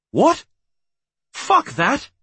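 high, loud indignant outburst; outraged. A narrator's reading. Husband rejects wife's protected-snake objection, outraged. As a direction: burst out in rattled disbelief.

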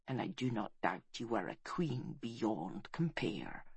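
soft, low voice; hushed, low farewell; tender reassurance. A narrator's reading. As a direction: comfort the dying gently, quietly. Man comforts a dying queen.

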